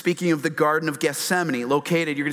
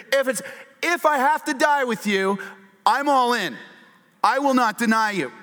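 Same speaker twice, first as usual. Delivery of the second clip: emphatic vow; 9 semitones higher than usual. Retold or imitated. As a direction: swear loyalty with fist on the table. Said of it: "Here's Peter".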